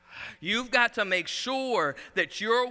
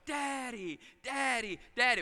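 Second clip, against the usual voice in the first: a child's call, lightly voiced and higher; higher, sing-song daddy calls; 5 semitones higher, 5 dB quieter than usual. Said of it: "she just started screaming".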